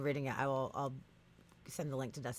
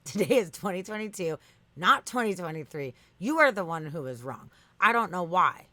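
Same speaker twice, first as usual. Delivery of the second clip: loud, emphatic chatbot argument; mock-indignant reading, hitting the key words hard; indignant insistence; 8 dB louder than usual. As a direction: hammer home each correction, acting out an indignant bot.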